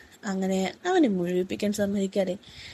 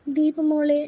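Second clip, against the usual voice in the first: called out high and louder; loving call, higher and louder; calling her name; 8 semitones higher, 4 dB louder, much slower than usual. A narrator's reading. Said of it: "അവൻ വിളിച്ചു".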